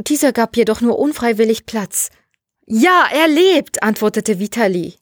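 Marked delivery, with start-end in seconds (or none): 2.7-3.8 high, loud, drawn-out yes; elated confirmation. Acted. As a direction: confirm it with slow, jubilant emphasis. Vitali confirms the joyful news.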